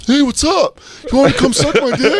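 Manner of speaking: high-pitched pretend boy calling out; hammed-up high decoy voice; mock decoy kid. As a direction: play the bait in a high, silly voice.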